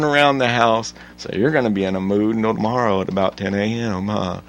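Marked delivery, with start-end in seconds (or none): none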